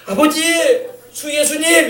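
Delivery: demonstrated prayer call; calling on God. Shown as a demonstration. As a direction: show them how to cry out to the Lord. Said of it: "부르시란 말이에요".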